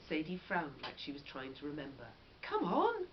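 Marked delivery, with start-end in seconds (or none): 2.4-3.1 urging call. Acted. Urging sister to hurry.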